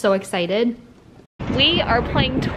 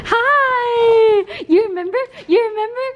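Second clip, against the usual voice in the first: high, gushing hello to a dog; excited pet greeting; 8 semitones higher than usual. Real, not acted. Owner asks dog if he remembers visitor, gushing.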